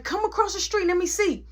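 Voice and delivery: high, hyped-up taunt; taunting challenge. Retold or imitated. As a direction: egg him on to come over. Daring him to cross.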